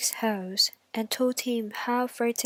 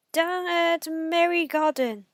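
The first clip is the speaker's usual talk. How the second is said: louder, higher coaxing wolf; eager wolf; wolf baiting the pig; sly wolf; 7 semitones higher, 6 dB louder than usual. Acted. Wolf answers.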